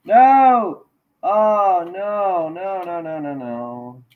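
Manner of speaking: dismayed cry. Real, not acted. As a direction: cry out as it snaps, then let each no fade. Headphones break during the stream.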